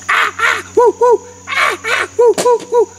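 high shrieks and whoops, alternating; high-pitched monkey hoots; monkey screeching. Retold or imitated. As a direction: imitate monkeys squabbling. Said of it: "And the monkey went".

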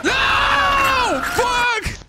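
very loud, high screamed words; full-force scream of defeat; screaming in panic. Real, not acted. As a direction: shriek it as you are killed. Dies in horror game.

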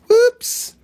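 high, loud yelp of oops; sudden high whoops; surprised whoops. Real, not acted. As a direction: pop it up high like a slip. Skipped over one item.

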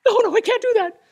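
very high, squeaky protest; squeaky refusal. Retold or imitated. Embarrassed person refusing the compliment in a squeak.

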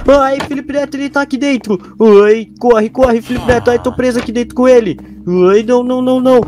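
high frantic panic, yelling to run; panicked cries to flee; scared panic. Real, not acted. Trapped inside with monster.

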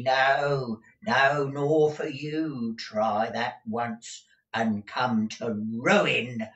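put-on drawn-out, defiant old-servant warning; threatening old housekeeper. A narrator's reading. Deaf Peg warning master off replacing her, threatening. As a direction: warn him, dragging out the final words.

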